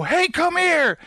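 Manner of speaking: high, urgent holler without real volume; mock chase shout; calling after someone. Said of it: "you can call after me".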